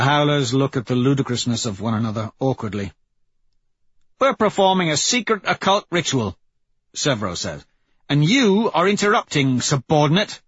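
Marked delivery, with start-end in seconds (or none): 4.2-6.4 high-pitched, self-important announcement; pitched-up, affronted retort; indignant explanation. Acted. Leader defends ritual to intruder. 8.1-10.4 high, pompous scolding; pitched-up, imperious telling-off; haughty rebuke. Acted. Leader scolds interrupting intruder.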